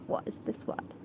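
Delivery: soft aside while puzzling over a word; muttering to oneself. Real, not acted. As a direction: drop the volume, as if checking privately. Checking a word to herself.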